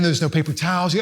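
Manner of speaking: ordinary talk, nothing marked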